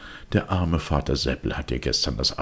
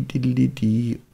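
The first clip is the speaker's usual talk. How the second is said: flute imitation; 10 semitones higher, 4 dB louder, slower than usual. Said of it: "die hellen Flöten".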